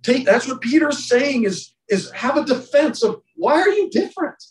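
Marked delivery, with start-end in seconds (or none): 3.4-4.4 mock challenge. Mock question from a partygoer.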